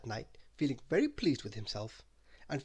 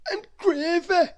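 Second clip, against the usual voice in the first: high, slow, louder greedy voice; Mister Greedy, shrugging off a cake, in a high slow voice; greedy character voice; 11 dB louder, slower than usual. Acted.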